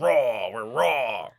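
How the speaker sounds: loud, stretched-out raw; hammed-up slow drawl. Host hamming up the raw uncensored episode title. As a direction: growl out raw slowly for effect.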